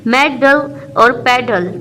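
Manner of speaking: Medal and pedal slowly broken into two beats; slow, with a break inside medal and pedal; split into syllables. Shown as a demonstration. Teacher sounding out two-syllable words, medal and pedal split slowly. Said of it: "टू syllable words में आ जाएंगे".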